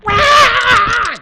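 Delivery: put-on high, loud baby cry; baby wail. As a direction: wail just as they fall asleep. Baby wakes the parents.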